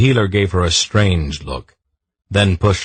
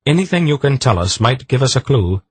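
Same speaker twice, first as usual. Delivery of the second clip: higher, pressing plea; urgent; 8 semitones higher, 3 dB louder than usual. A narrator's reading. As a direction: push her to remember. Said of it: "Polgara said urgently".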